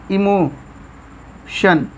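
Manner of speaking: very slow, drawn out; slow demo. Shown as a demonstration. Teacher showing students how to say it, slowly. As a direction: stretch emotion out slowly. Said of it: "लेकिन हम लोग पढ़ते हैं क्या".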